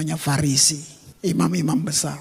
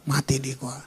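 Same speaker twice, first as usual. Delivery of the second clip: a touch of dread; 7 semitones lower, 7 dB quieter than usual. Acted. Peter's fearful thought.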